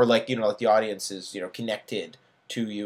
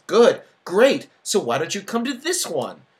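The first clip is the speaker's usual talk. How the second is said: eager; 9 semitones higher, 7 dB louder than usual. Acted. Narrator pushing Bert to party.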